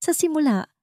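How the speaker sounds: ordinary delivery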